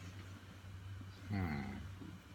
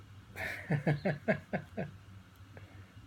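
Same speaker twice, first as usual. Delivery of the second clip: airy laugh; 11 semitones higher than usual. Real, not acted. Laughs at the poem's paradox.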